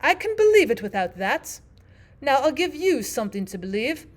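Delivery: high, loud storybook Queen; Queen character. Acted. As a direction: announce it as the Queen.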